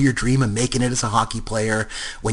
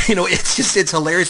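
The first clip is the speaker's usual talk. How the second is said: normal talking voice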